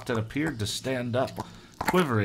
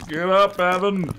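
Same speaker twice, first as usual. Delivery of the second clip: higher, louder warning cry; frantic cry; 9 semitones higher, 7 dB louder than usual. Acted. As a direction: yell at him to move. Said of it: "Andy cried".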